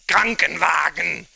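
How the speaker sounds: "Krankenwagen" loud and harsh in German. Shown as a demonstration. Streamer mocking how harsh German sounds, loud. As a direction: bark "Krankenwagen" like an angry German. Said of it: "and then German".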